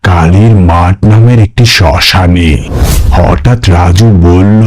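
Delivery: low, measured storyteller voice; horror story narration. A narrator's reading. Narrator setting up a ghost story, low and measured. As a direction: set the eerie scene in a low voice.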